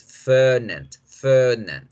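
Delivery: Fernand with a long English er; English pronunciation. Shown as a demonstration. Teacher gives the English version, long er in Fernand. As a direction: stretch the first vowel of Fernand as English er. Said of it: "en inglés".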